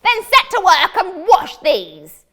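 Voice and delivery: loud, high barking wizard command; gruff wizard order. Acted. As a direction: bark the order like a nasty wizard.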